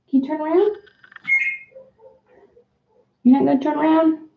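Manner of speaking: high sing-song coaxing voice for a parrot; pet talk. Real, not acted. Talking to her parrot to get it to speak, high sing-song pet voice.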